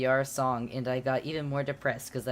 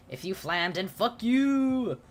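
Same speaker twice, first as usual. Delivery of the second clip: angry outburst; 8 semitones higher than usual. Acted. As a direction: build to a shrieking insult. Author's note insulting flamers.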